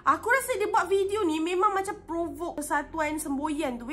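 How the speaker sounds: ordinary talk, nothing marked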